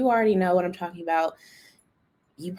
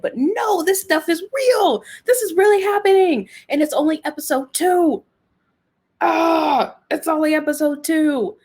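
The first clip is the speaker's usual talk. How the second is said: high-pitched, exasperated exclaiming; incredulous outburst; 9 semitones higher than usual. Real, not acted. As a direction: exclaim it high, insisting against all belief. Disbelief at the plot being real.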